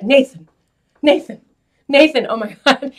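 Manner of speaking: lightly voiced diva, calling across a room; parody calling out. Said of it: "where he calls himself [name]".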